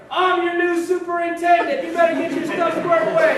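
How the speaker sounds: loud, high barking shout; drill sergeant yell. Retold or imitated. Senior sergeant yelling at new airmen, loud shout. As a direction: bark orders at the troops. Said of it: "I hear Larry Meeks in the background".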